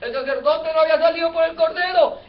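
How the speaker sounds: normal talking voice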